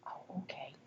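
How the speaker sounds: hushed, barely voiced; quiet whispered aside; soft whisper. Shown as a demonstration. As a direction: whisper it gently to regain attention. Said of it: "when I changed my volume".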